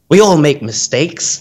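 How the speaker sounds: slow, wry Australian bloke; lightly voiced bogan Aussie confession; Aussie waiter in Norway sighing in a bogan Aussie accent. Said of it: "the most bogan Aussie".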